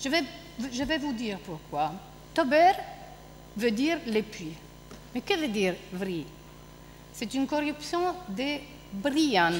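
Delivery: quiet dramatic reading; reading a play scene. Acted. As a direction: read the character's lines to the class.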